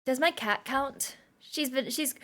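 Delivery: ordinary talk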